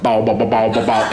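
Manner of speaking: put-on low rhythmic bow bow to calm a baby; playful shushing sound for a crying baby; baby-soothing noise. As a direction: bounce the syllables like soothing an infant. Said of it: "I do that noise".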